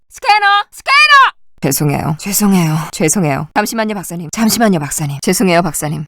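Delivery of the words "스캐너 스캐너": high, loud warning cry; alarmed shout. Acted. Citizen alerting others to a scanner, alarmed shout. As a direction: cry out the danger.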